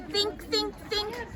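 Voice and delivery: think, think, think, gently to himself; Pooh thinking hard. A narrator's reading.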